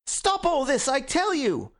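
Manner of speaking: high-pitched, desperate outburst; frantic cry. A narrator's reading. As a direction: beg frantically for it to stop. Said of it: "cried Gregory with frantic face and hands".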